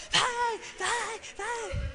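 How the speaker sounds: high mock cheer from the stands; cheering grandpa. Grandfather cheering at granddaughter's game, high and keen. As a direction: egg her on like an excited grandpa.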